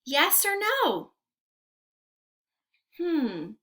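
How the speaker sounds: ordinary talk, nothing marked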